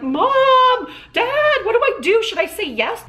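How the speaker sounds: put-on a grown woman suddenly sounding like a frightened child begging her parents; like a scared little kid. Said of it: "like, a twenty-eight-year-old adult".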